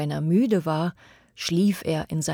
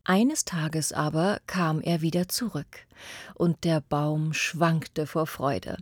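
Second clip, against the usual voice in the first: ordinary delivery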